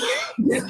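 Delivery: sharp high burst of laughter. Real, not acted.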